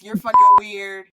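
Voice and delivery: slow, loud, laughing scold; mock scolding. Friend teasing her, slow loud laughing scold. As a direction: tease her through a laugh. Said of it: "her to be like".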